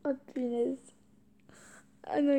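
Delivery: ordinary delivery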